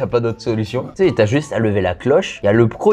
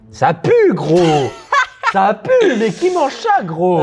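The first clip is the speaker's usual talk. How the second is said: disgusted shriek; 7 semitones higher, much slower than usual. Real, not acted. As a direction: squeal at the stink. Reacting to the stench.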